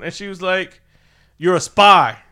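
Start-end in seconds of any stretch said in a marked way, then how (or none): 1.4-2.2 high, loud hammed-up accusation; loud mock accusation of a spy. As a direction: snap it out as a gotcha. Accusation retold.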